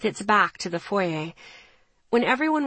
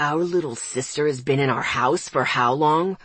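shaking with anger; 6 semitones lower than usual. Acted. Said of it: "his voice shaking with anger".

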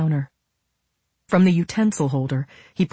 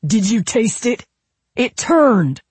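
raised, high-pitched furious yelling; shouted accusation, higher and louder; angry shout; 7 semitones higher, 6 dB louder, slower than usual. A narrator's reading. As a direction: build to an outraged shout on the last word. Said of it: "he shouted".

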